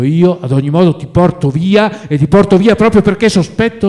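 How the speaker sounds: loud, high, rising in urgency; forceful insistence. A narrator's reading. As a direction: insist on dragging a friend away. Friend insists on taking him away.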